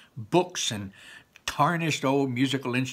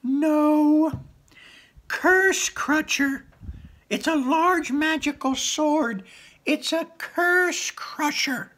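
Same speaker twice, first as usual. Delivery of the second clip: very high, slow, exasperated creature voice; frustrated manticore; 5 dB louder, much slower than usual. Acted. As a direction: correct her slowly, losing patience. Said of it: "said the Manticore, frustrated".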